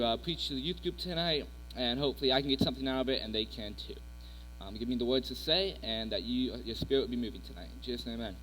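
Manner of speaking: soft, quiet prayer voice. Acted. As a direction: pray gently to close the thought. Leads a prayer.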